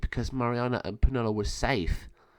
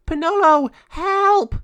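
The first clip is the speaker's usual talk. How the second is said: high, loud desperate scream; cry for help; 11 dB louder, slower than usual. Acted. Mariana caught in the raven's claws, screaming for help. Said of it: "caught Mariana in her claws".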